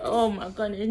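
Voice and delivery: ordinary talk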